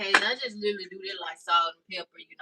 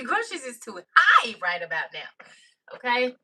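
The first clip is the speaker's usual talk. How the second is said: loud outburst, leaping up in pitch; comic loud complaint; 3 semitones higher, 5 dB louder than usual. Real, not acted. Cook complaining about grocery prices, loud comic outburst. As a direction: exclaim it in comic outrage.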